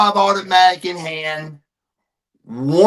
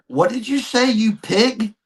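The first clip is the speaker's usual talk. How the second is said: brother's challenge; 6 semitones higher than usual. Retold or imitated. Brother confronts the cop.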